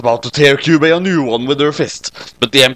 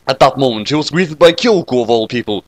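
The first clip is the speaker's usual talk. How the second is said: ordinary delivery, nothing marked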